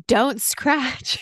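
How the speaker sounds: mild imitation of a scolding parent; half-hearted yell, like a nagging parent; mock parental yell. Imitating parents yelling at a kid not to scratch, mild mock yell. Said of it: "your parents yelling at you".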